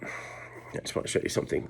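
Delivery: breathless whisper, nearly silent. Real, not acted. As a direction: whisper so as not to wake anyone. Real whisper to listeners at night.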